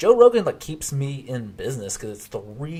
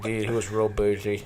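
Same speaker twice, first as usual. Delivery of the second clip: mock lisping. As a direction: lisp through the words. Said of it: "someone with a really bad lisp".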